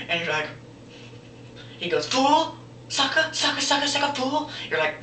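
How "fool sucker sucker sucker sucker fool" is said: high-pitched mock tough-guy taunts; Mister T. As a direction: rattle off insults as a hammed-up TV tough. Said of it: "You're acting like Mister T".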